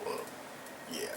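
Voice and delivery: usual speaking voice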